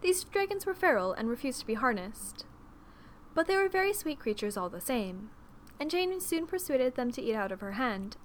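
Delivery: ordinary delivery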